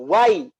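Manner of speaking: "wai" sung out loud and smooth; soft glide. Shown as a demonstration. Teacher isolating the syllable for students, smooth glide. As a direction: glide "wai" lightly without forcing. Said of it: "Bacanya gitu".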